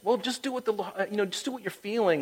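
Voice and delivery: high, breezy mock advice; glib advice, mimicked.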